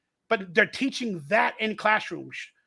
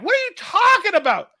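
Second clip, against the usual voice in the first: higher, louder disbelieving question; incredulous; 9 semitones higher, 6 dB louder than usual. Retold or imitated. Friends challenging him, high indignant disbelief. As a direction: fire it back incredulous. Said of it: "People are like".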